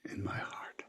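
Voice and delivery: whispered, choking on grief; choked whisper. Real, not acted. Telling how the guru's death hurt, whispered. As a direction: barely get the words out.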